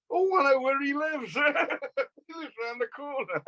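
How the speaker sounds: high voice breaking into laughter; laughing punchline. Retold or imitated. Husband directs [name] to [name]'s house, told laughing. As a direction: deliver the quote through laughter, pitched well up.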